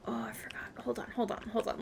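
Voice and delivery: unvoiced whisper to herself. Real, not acted. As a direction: breathe it, no voice. Whispering to self mid-game.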